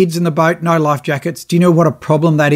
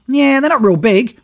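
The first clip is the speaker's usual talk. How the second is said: offhand skipper; 8 semitones higher than usual. Retold or imitated. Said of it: "he looks outside and goes".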